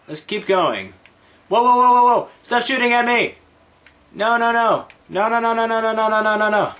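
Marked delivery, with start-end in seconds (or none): 1.5-6.7 high loud frantic cries; panicked yelling. Real, not acted. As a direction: yell in a panic under fire. Being shot at in game.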